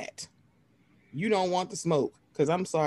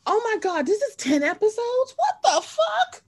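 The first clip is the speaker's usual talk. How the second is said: much higher, laughing-crying shock; shocked disbelief. Retold or imitated. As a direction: shriek it in laughing disbelief, dragging the last words. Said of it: "I'm like".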